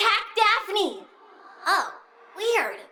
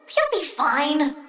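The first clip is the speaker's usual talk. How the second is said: usual speaking voice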